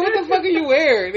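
laughing through the quoted question; half-laughing as he re-asks it; laughing retold question. Retelling the TV scene to friends, laughing. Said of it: "he's like".

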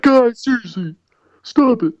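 high, whiny complaint; annoyed whine. Retold or imitated. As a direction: whine at pesky brothers. Annoyed teen telling brothers off.

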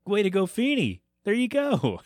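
high, sing-song mock congratulations; mock cheer. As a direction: cheer him on in a teasing high voice. Group mock cheer for Feeney.

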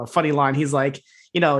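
ordinary talk

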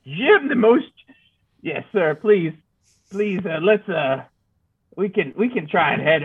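a grizzled frontiersman rambling; old prospector voice. Acted. Player voices his character.

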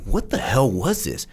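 drawn-out what-the-hell thought; inner disgust. Retold or imitated. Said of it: "inside I'm like".

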